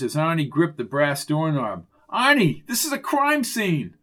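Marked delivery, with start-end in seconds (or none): 2.1-3.9 high, raised voice of alarm; high-pitched officious warning; urgent warning. Acted. Officer warns Arnie off.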